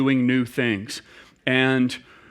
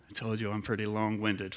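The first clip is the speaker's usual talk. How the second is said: ordinary talk, nothing marked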